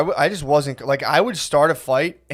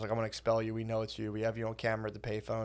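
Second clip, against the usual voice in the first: quiet, fast mock principal threat; hushed principal imitation; 5 semitones lower, 16 dB quieter, faster than usual. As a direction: murmur the principal's threat quickly. Quoting principal, dropping voice.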